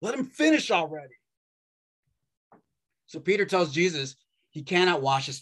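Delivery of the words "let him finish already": exasperated disciples. Retold or imitated. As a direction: roll your eyes at Peter and tell him to zip it. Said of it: "the other disciples in the room being like".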